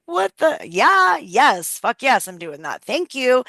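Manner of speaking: high, loud burst of joy on What the and yeah, easing to eager; excited yes. Retold or imitated. Retelling the delighted reaction to the bank text, excited high yell. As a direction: retell the thrill of free money like it's happening now. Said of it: "I'm like".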